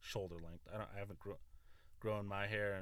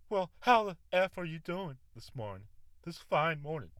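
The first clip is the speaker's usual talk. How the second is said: high, louder, cheery mock voice; imitating Winston; 6 semitones higher, 9 dB louder than usual. Imitating Winston's cheery cussing good morning. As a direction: chirp it like a foul-mouthed morning greeter. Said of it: "you say".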